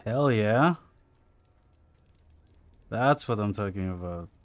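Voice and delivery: loud, thrilled whoop; excited cheer. Real, not acted. Streamer cheers a game moment.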